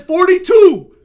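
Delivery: high, booming mock reply; loud announcement. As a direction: belt out the age as a punchline. Acts out her shocking answer.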